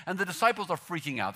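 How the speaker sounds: usual speaking voice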